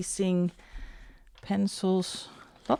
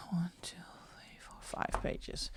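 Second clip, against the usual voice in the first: whispering; 14 dB quieter than usual. Real, not acted. Whispered counting of pages.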